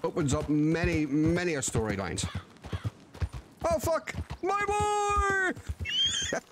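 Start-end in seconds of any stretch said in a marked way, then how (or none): 3.6-6.4 surprised shout. Real, not acted. Reacting to son's letter.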